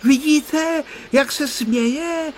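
high-pitched, drawn-out excited exclamation; thrilled, slow high cry. A narrator's reading. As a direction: call out excitedly, stretching the words. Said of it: "vykřikl vzrušeně Honzík".

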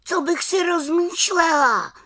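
indignant cry. A narrator's reading. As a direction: cry out in a high little-witch voice. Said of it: "vykřikla malá čarodějnice". (výkřik malé čarodějnice vysokým hlasem)

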